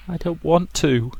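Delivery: put-on a scared girl barely holding together; voice shaking. Rosa refusing her mother, voice shaking. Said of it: "Her voice shook".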